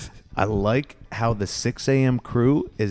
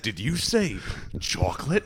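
cartoon voice; 2 semitones higher than usual. Quoting SpongeBob episode.

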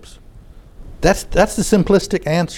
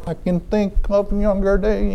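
high, slow crooning falsetto; 5 semitones higher, 4 dB quieter than usual. Retold or imitated. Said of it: "I can't do my [name] impression".

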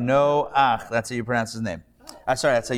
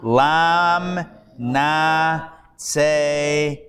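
slow sounding out; much slower than usual. Shown as a demonstration. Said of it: "Again, la minatseach".

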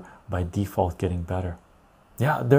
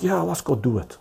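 higher, lightly voiced eager crowd; eager gullible Americans; 7 semitones higher than usual. Mimicking Americans cheering on the Iraq war, lightly mocking. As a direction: toss it off like a gung-ho American. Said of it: "And Americans were like".

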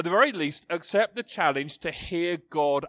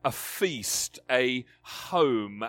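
ordinary talk

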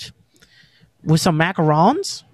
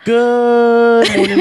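drawn-out greeting; 4 semitones higher, 6 dB louder, much slower than usual. Acted. Podcast intro greeting, drawn out.

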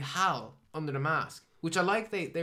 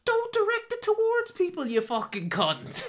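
much higher and louder, a furious squeal; mock shriek; 7 dB louder than usual. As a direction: screech it like you've lost it. Angry quote during rant.

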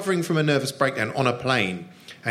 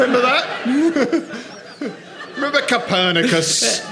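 laughing, pitched way up and slow; high laughing mock question; 10 semitones higher, 3 dB louder, slower than usual. To his co-host about old references, high laughing mock questions. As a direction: tease him with a giggling high quiz.

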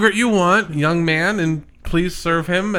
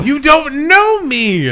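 high, slow, emphatic protest; indignant rejection; 8 semitones higher, 4 dB louder, slower than usual. Acted. Woman rejecting a sudden declaration of love, indignant protest. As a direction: push back hard, stretching the words.

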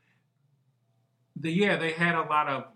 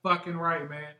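excited; 7 semitones higher, 9 dB louder than usual. Real, not acted. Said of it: "You're very excited".

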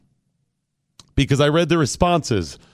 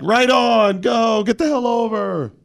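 cheering commenters; 8 semitones higher, slower than usual. Retold or imitated. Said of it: "Other people were like".

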